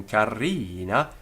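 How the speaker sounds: "carina" with the ri drawn out; long I. Shown as a demonstration. Teacher explaining quantity, "carina" with long i. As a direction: hold the middle vowel of "carina". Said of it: "give it a long I".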